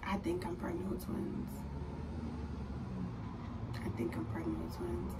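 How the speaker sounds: very soft, secretive whisper; inner-voice whisper. Retold or imitated. Patient secretly suspecting twins, hushed inner voice. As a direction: whisper it like a thought in the back of the mind. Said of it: "little piece in the back of my mind".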